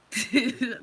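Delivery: short, very loud laugh. Real, not acted. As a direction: burst out laughing. Amused by viewer's comment.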